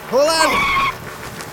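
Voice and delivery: sharp, high call to hold tight; urgent shout. Acted. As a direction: shout over the engine. Swerving on the motorcycle.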